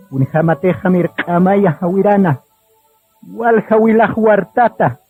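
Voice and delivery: normal talking voice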